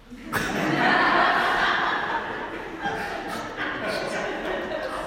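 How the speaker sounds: high, breathy unvoiced laughter; real laugh. Real, not acted. Priest recalling his helpless novice master, giggling. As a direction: dissolve into giggles.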